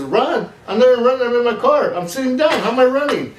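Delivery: high, loud, incredulous mock Cuban accent. Driver in his car denying he ran, imitated Cuban accent. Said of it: "and he has an accent".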